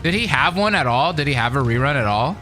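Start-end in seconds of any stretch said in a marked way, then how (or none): none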